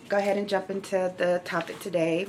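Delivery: ordinary talk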